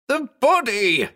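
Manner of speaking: high, frantic cry; screamed. A narrator's reading. Lois struggling to get past the crowd, screaming. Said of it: "Lois screamed".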